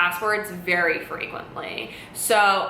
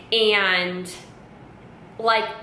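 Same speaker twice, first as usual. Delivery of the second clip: usual speaking voice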